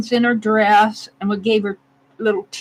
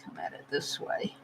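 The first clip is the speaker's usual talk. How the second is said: very soft whisper to yourself; hushed, barely voiced self-talk; absorbed murmur; 18 dB quieter than usual. Real, not acted. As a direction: murmur under your breath while concentrating on carving. Murmuring to self while carving.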